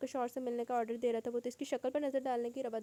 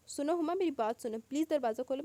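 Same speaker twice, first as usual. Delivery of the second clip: raised pleading call, higher and louder; calling through the door; 5 semitones higher, 6 dB louder than usual. A narrator's reading. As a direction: beg loudly through a closed door. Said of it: "وہ باہر سے آواز دے رہا تھا". (دروازے کے پار سے بلند آواز میں التجا)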